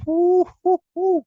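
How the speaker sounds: high loud falsetto whoos; hyped whooping. Pretend. Fan reacting to Julius showing up, high loud whoos. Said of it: "And I'm like".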